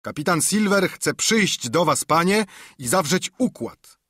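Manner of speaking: high, loud shout from afar. A narrator's reading. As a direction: call it up to the fort. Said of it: "krzyknął". (wysoki, głośny okrzyk z daleka)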